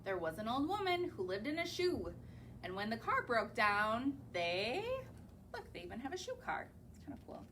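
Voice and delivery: plain reading voice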